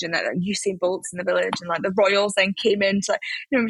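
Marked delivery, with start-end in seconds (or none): none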